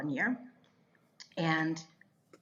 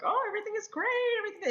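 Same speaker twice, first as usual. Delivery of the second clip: high, fast fake-cheery voice; put-on cheerfulness; 13 semitones higher, much faster than usual. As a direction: pretend all is fine, far too high and quick. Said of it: "you try to keep".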